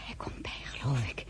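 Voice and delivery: hushed unvoiced whisper; breathless whisper at the bedside. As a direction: whisper so as not to wake him. Whispering over an unconscious man.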